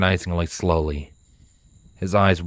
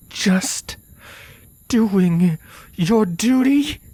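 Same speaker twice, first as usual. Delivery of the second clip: high, strained, seething disbelief; slow, halting question, barely contained rage; whispered through clenched teeth; 13 semitones higher, much slower than usual. Acted. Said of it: "She whispered through clenched teeth".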